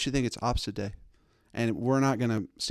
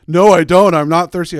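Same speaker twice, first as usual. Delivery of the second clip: put-on childish loud refusal; 6 semitones higher, 14 dB louder than usual. Kid refusing on opposite day.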